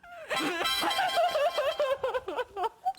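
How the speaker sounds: high helpless laugh; high burst of laughter. Real, not acted. Laughs during tickling test.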